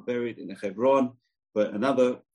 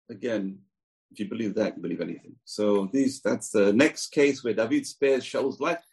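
usual speaking voice

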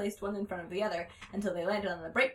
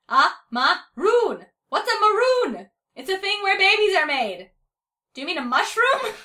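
loud squeaky argument; 10 semitones higher, 12 dB louder than usual. A narrator's reading. Two cats shouting about a maroon, high and loud. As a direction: shout across the yard.

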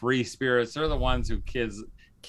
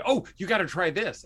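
eager suggestion; 8 semitones higher than usual. Retold or imitated. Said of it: "somebody will say".

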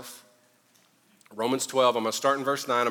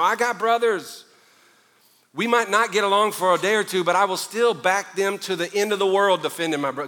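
ordinary talk

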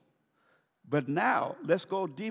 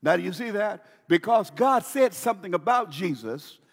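ordinary talk, nothing marked

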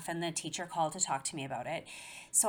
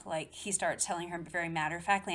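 ordinary talk, nothing marked

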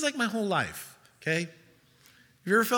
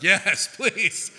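eager laughing please. Retold or imitated. Disciples answer Jesus eagerly through a laugh. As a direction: beg with a laugh.

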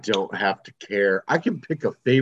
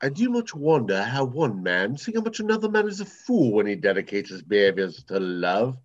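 mock-royal British stage accent; theatrical upper-class British delivery; highborn British Shakespearean. Shown as a demonstration. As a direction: recite Shakespeare in a put-on highborn English accent. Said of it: "shift between Shakespeare and Monty Python".

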